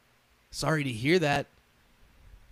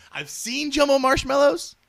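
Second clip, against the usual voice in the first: real laughter breaking through; laughing; 12 semitones higher than usual. Real, not acted. Driver cracking up over the marshmallow talk, laughing. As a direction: laugh it out in disbelief.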